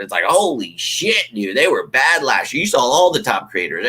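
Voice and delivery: ordinary talk, nothing marked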